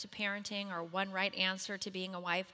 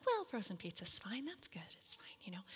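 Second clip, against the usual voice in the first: hushed, unvoiced reassurance; an embarrassed whispered cover-up; awkward whisper; 9 dB quieter than usual. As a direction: whisper it as if the friend shouldn't hear.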